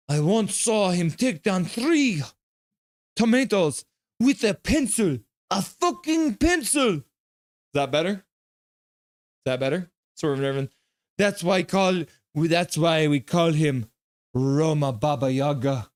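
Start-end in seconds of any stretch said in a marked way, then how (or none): 0.1-7.0 high, slow, heavy Russian accent; hammed-up Russian accent, much higher on the punchline. Shown as a demonstration. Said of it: "say in a Russian accent". 11.2-15.9 slow, put-on Russian accent; mock-solemn Russian accent. Shown as a demonstration. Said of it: "say in a Russian accent".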